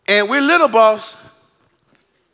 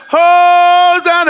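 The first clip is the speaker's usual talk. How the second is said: drawn-out, higher and louder Hosanna; crowd shout; 5 semitones higher, 5 dB louder, slower than usual. Retold or imitated. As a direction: call it out like one voice in the crowd. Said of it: "somebody else said".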